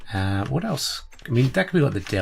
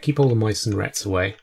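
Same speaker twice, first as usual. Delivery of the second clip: ordinary delivery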